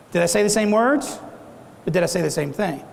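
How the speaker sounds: ordinary talk, nothing marked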